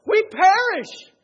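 high, loud, desperate cry for rescue; a loud high frightened plea; panicked plea. Retold or imitated. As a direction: beg to be saved from drowning.

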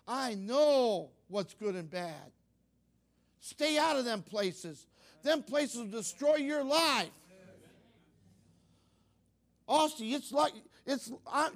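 loud, insistent sermon warning; fervent preaching. Real, not acted. Preacher warning the kids from his own past, fervent preaching. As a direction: drive it home, leaning hard on key words.

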